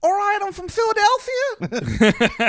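put-on Philadelphia accent. Podcast guest shows off his Philadelphia accent, high and slow. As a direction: squeal it out in a cartoon Philly accent, slowly. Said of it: "Philadelphia accent? I can do a flawless Philadelphia accent".

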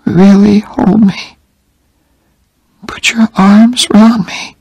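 slow, high whisper; faint whispered plea. A narrator's reading. Dying Jenny asking Oliver to hold her, slow whisper.

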